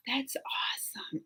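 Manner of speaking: hushed, breathy amazement; awed whisper. Real, not acted. Host reacts to flamingos near a viewer's city, awed whisper. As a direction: whisper it in wonder.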